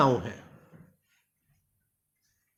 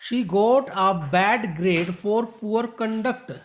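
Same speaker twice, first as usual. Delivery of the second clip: very slow reading of the sentence; slow model reading; much slower than usual. Shown as a demonstration. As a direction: read word by word for the class. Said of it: "teacher reads next sentence". (धीमी पढ़ाई)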